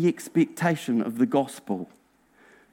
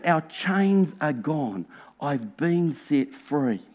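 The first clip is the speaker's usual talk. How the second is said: mild lyric quote; 4 semitones lower, 7 dB quieter, slower than usual. Retold or imitated. Sings lyrics to himself.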